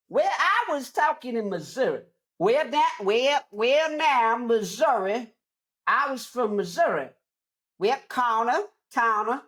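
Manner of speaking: put-on grandma's Missouri accent. Imitating grandma's Missouri accent for viewers. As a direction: keep doing the Missouri grandma. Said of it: "That's how my grandmother sounds".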